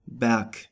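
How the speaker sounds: Berg ending in a K sound; final G as K. Shown as a demonstration. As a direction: say Berg with the G hardened to K. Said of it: "it is pronounced like a K".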